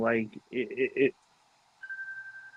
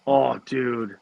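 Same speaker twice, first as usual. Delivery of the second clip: usual speaking voice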